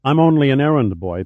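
usual speaking voice

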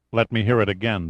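usual speaking voice

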